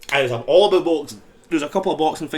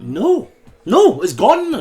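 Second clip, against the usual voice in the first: emphatic mock refusal; 8 semitones higher, 8 dB louder, slower than usual. Acts out refusing to recite a line.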